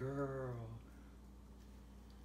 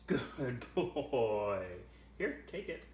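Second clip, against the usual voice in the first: usual speaking voice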